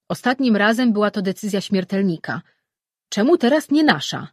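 protesting god. Acted. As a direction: push back at the other gods. Saru presses the question.

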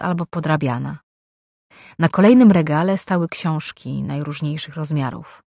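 plain reading voice

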